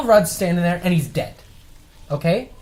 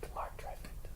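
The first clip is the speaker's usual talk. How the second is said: whispered name in the credits; 25 dB quieter, slower than usual. Acted. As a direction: breathe the name like a secret. Joining the trailer credits.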